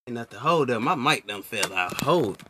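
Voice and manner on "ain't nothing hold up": normal talking voice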